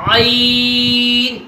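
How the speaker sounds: عین held very long from the throat; drawn-out letter name. Shown as a demonstration. Teacher demonstrating the throat letter. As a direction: stretch عین gently. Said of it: "عین کو اپنی صحیح ادائیگی میں ادا کریں".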